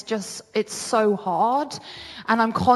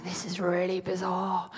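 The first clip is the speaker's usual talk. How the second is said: hushed, whispered disbelief; near-silent aside of amazement; bewildered whisper; 2 semitones lower, 9 dB quieter than usual. Retold or imitated. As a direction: whisper it, stunned at the strangeness. Retelling her dream reaction, stunned.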